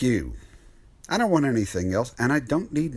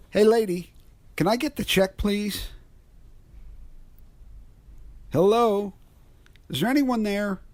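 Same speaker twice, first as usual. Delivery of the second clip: raised call into an empty diner; 9 semitones higher than usual. Acted. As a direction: call for someone who isn't there.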